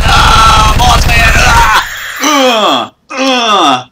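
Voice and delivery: high, loud shouts with a cry; frantic loud crying shouts; panicked shouting. Real, not acted. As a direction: yell in panic while fighting. Fighting Slender in panic.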